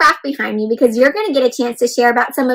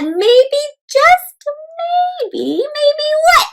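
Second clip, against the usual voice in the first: suspenseful tease; 13 semitones higher, much slower than usual. Acted. Teacher building suspense for kids.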